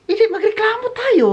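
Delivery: high mock voice of Dad. Quoting Dad Egbert's scolding reply, high mock voice. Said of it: "Sabi niya".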